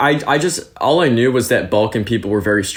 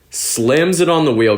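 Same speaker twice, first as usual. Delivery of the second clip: ordinary delivery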